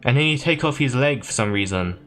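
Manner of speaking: ordinary talk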